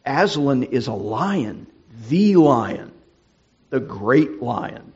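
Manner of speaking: loud, proud Mister Beaver in Narnia; emphatic Mister Beaver. Acted. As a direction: proclaim him king, full of awe. Voices Mister Beaver in the story.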